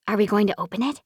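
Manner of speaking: usual speaking voice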